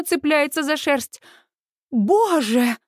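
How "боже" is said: ordinary delivery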